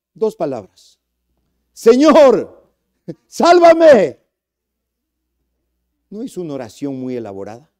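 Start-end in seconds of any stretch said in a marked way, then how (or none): 1.7-4.2 high, loud cry for rescue; loud desperate plea. Acted. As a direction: shout it like sinking in the water. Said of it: "Suplicó, rogó".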